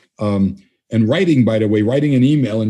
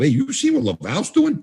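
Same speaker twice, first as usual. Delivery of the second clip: casual tip-off. Retold or imitated. Coaching colleagues what to tell Bob.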